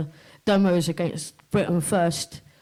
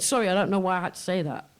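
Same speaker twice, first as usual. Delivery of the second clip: ordinary talk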